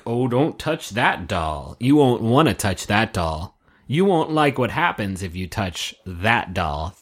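slow, hammed-up haunted-house host; mock ominous warning. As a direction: warn slowly, drawing out each word. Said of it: "Boo says not to uncover".

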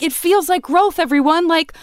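rally the crowd with naive enthusiasm; earnest cheerleading. Acted. Outsider urging everyone through hard conversations, earnest cheerleading. Mocking herself cheering on from afar.

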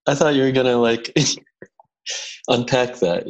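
ordinary delivery

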